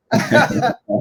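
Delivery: a light amused laugh. Real, not acted. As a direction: chuckle along. Laughing after the joke.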